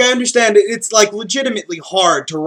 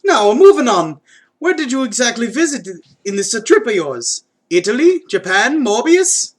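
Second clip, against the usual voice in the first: higher, hammed-up Mario-style Italian accent; Mario's accent, pitched up and earnest; 6 semitones higher than usual. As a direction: play it as a heartfelt Mario with his accent. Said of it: "keeping the accent for Mario".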